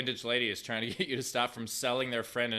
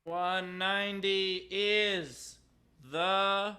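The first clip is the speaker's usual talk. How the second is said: slow, high, drawn-out build; announcer build-up; 7 semitones higher, much slower than usual. Acted. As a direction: stretch it out like a game show host. Announcing auction winner.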